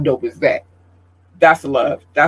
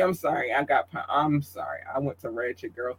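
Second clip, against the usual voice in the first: quiet aside; 2 semitones lower, 12 dB quieter than usual. Real, not acted. Embarrassed aside.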